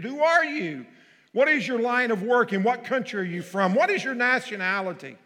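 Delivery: high, loud frantic questioning; demanding. Acted. Sailors demanding answers from Jonah, loud and high. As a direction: fire the questions at him in panic. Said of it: "they demanded".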